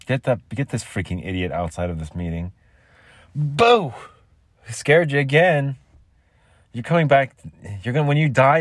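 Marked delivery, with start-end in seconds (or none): 3.4-3.9 loud high boo; startling boo. Acted. Prankster interrupts. 4.7-8.6 high, loud teasing taunt; taunting prankster. Acted.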